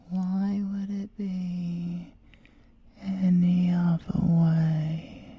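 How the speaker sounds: slow breathy whisper; slow teasing whisper; whispered taunt. Acted. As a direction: whisper it as a dare. Taunting the inspector.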